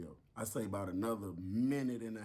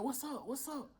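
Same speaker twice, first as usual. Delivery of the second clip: concerned officer. Retold or imitated. Officer voiced checking.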